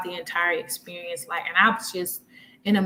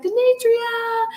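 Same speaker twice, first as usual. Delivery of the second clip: drawn-out high call across the lobby; calling her name; slower than usual. Retold or imitated. Cousin calling her name near the exit, drawn out and high. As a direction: stretch the name out like calling from afar. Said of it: "she starts calling my name".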